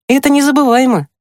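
put-on very high, quick girlish exclamation; amused delight. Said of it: "Маша засмеялась". (весёлый, очень высокий быстрый возглас)

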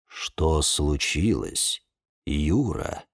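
low, soft, slow question; calm. A narrator's reading. Bonsai calmly asking the panicked boy, low calm voice. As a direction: ask it unhurried amid the chaos. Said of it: "спокойно спросил".

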